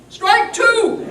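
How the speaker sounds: very high, loud umpire's call. A narrator's reading. The umpire calling the second strike, loud high call. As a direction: bark the call across the field. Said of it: "and the umpire said".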